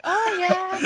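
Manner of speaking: high, put-on airy agreement; mock casual evasiveness. Keeping the podcast secret from others, mock innocent. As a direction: pitch it up, playing innocent. Imitates own evasive response.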